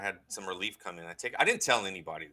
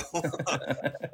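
a quick embarrassed chuckle; short laugh; 5 semitones higher than usual. Real, not acted. New believer laughing about praying silently. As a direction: laugh at your own timidity.

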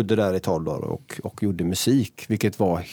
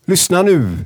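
a short, higher, louder mock order in his voice; Marcus Birro impression; 8 semitones higher, 6 dB louder, slower than usual. Imitating a friend on request, raised and loud. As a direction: lightly mimic a friend barking "listen now". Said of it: "Kan du härma Marcus Biro?".

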